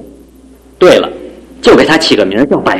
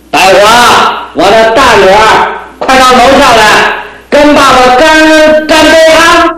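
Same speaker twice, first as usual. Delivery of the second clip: put-on calling out drunkenly; 10 semitones higher than usual. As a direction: yell up the stairs, drunk. Drunk father calls daughters upstairs.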